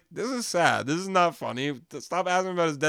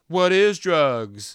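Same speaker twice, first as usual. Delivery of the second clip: drawn out, louder, dazed; slow dazed meme voice; 3 semitones higher, 7 dB louder, much slower than usual. Retold or imitated. Podcaster quoting the arrested guy, slow and dazed. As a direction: stretch each word like a dazed arrestee.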